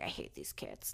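a hushed, low mock grumble; imagined grumpy bartender. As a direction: whisper the bartender's imagined annoyance. Imagined bartender's secret thought.

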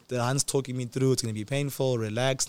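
ordinary talk

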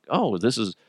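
mock moviegoer.

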